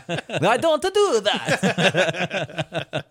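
very slow, high, laughing through the words; drawn out through giggles; laughing denial. Real, not acted. Denying while laughing.

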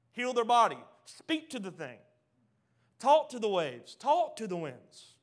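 loud, rising sermon build; full-force preaching. Acted. Preacher rallying the church against sickness, full force. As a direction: preach it out to the crowd, hammering each line.

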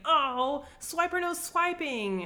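high, squeaky cartoon baddie whine; cartoon villain. The demon Karagor caught stealing the baby, squeaky cartoon whine. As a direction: sound like a foiled cartoon villain.

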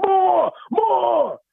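high-pitched hollered Rusty voice; yelled demand. Acted. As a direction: holler it high, drawing the word out.

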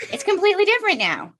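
high, fast sing-song quote; mock exasperated realization. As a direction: toss it off in a high, quick mocking voice. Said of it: "And then you have your own child and you're like".

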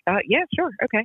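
high, unsure mock yes; hesitant agreement. Quoting her own stunned reply to Glenn's phone call, high and hesitant. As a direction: hide the panic behind a reluctant yes. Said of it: "And I'm like".

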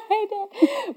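high giggly hello; laughing greeting. Real, not acted. Daughter greets dad watching the show, giggling.

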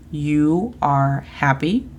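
slow, carefully spaced English for learners; slow model phrase. Shown as a demonstration. Teacher modelling English for Spanish learners, slow and clear. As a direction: sound out each word slowly like a teacher. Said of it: "Con emociones".

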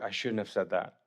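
low, hushed aside to himself; sheepish regret. Acted. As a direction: drop it low and soft, caught out. Acting Nathanael's embarrassed regret.

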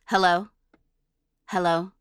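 flat, toneless ghostly hello; man doing a woman's voice, deadpan; flat girl's voice. Retold or imitated. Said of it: "Flatly with no inflection".

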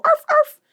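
high, yappy mock barking; squeaky pretend arf-arf; dog bark imitation. Imitates a dog after saying perro.

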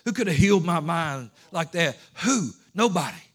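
building to loud, full-force preaching; preaching climax. Real, not acted. Call-and-answer climax of sermon.

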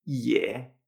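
low third-tone "ye", open Spanish e. Shown as a demonstration. Teacher showing learners the third tone, "ye" with Spanish E. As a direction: say "ye" with a clear Spanish e. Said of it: "Esta E es igual que la española".

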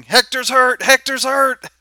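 high, loud shriek of a fight announcer; high-pitched excited announcer yell. Acted. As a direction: cry out the injury like a stunned ringside commentator. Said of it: "the announcer just shrieking".

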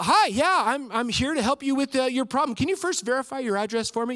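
a mock chipper call centre rep; chirpy support agent. Support agent greeting the frustrated caller, mock chipper. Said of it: "more impressions of DirecTV people".

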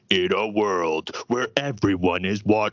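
amateur deep trailer voice, slowed down; slow, strained movie trailer narrator; movie guy voice. Shown as a demonstration. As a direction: force a movie announcer as deep as possible. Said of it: "tried to go as deep as possible".

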